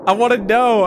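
can't believe it flashed past, begging chat; excited frustration. Real, not acted. Gamer begging viewers to explain missed text, excited and high.

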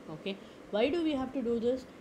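ordinary talk

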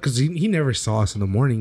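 normal talking voice

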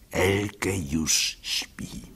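very slow whisper; whispered, very slow, tiptoeing into the room; hushed whisper. A narrator's reading. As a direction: whisper so as not to wake her. Sneaking into dark house.

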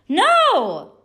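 put-on very high, loud child's no; a squeaky stubborn no; emphatic no. A narrator's reading. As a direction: Stephanie refusing mom a third time, high and loud. Stephanie refusing her mom.

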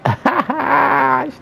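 a high cackle at the loser; high mocking laugh. Real, not acted. Gamer mocks the opponent he just beat, high cackle.

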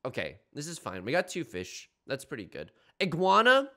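ordinary talk

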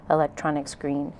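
ordinary delivery

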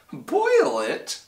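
very high, aghast question; gasped. A narrator's reading. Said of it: "gasped Horton".

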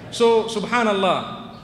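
normal talking voice

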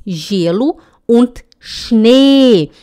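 usual speaking voice

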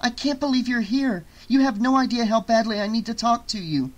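high, excited urgency; amazed and eager. Acted. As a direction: gush it, desperate to talk. Jess amazed, desperate to talk.

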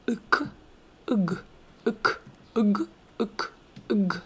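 Uk, ug alternated as separate short syllables; teacher's syllable drill on uk, ug; pronunciation drill. Shown as a demonstration. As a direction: drill uk and ug with the vowel tucked before K and G. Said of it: "put your tongue in the correct position".

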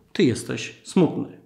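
ordinary talk, nothing marked

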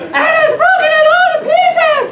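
very high, loud squeak; piercing falsetto baby bear; wee little baby bear voice. Acted. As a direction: squeal it like an outraged tiny bear. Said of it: "in his wee little voice".

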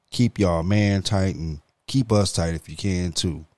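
ordinary delivery

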